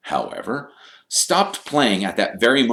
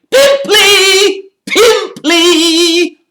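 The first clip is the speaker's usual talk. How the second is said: piercing singsong taunt; yelling Peeves; 12 dB louder, much slower than usual. A narrator's reading. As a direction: yell the nickname very high, stretched out.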